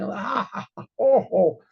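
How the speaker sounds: high bleating ram noise, a touch mocking. Shlomo mimics a ram for laughing partygoers, bleating. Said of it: "he makes like a ram".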